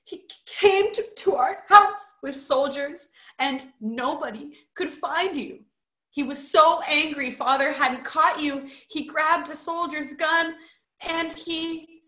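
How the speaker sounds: high, stammering, tearful account; loud at first, breaking off unfinished at the end; sobbing. A narrator's reading. Roderick telling how his father died, tearful and high. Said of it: "sobbed Roderick".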